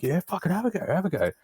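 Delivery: high mock egging-on voice; imitating a rowdy bloke. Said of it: "some guys like".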